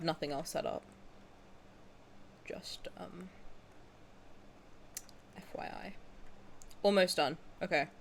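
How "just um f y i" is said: unvoiced whispered aside; whispering. Real, not acted. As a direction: drop to a breathy whisper. Whispering aside while setting up.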